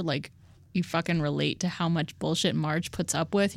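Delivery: normal talking voice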